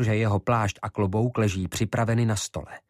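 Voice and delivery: plain reading voice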